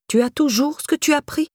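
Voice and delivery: a severe, pointed question, pitched high; stern question. A narrator's reading. Irène questioning Laura about the stolen bag, stern. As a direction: ask it sternly. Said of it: "demanda-t-elle sévèrement".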